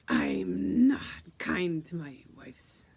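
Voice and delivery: loud, slow, heavy growl; menacing being. Retold or imitated. Being warning someone who wants to love him, menacing. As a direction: draw it out loudly as a threat.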